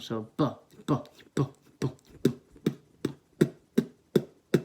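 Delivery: slow bu bu, the vowel fading out; slow kick drill. Shown as a demonstration. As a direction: ease the vowel out beat by beat. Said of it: "You're starting with your straight B sound".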